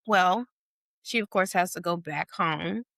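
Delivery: ordinary delivery, nothing marked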